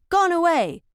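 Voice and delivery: high mock shout of Tally-ho; a narrator's lightly hammed hunting cry. A narrator's reading. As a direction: call it like a rider after hounds. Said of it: "shouts of".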